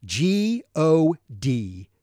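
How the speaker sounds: G O D spelled out slow and deliberate; spelled out letter by letter. Shown as a demonstration. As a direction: spell G O D slowly, one letter at a time. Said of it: "Notice the emphasis on God".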